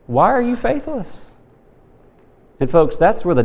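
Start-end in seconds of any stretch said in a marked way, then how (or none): 0.1-1.3 gentle question. Acted. Jesus asking disciples.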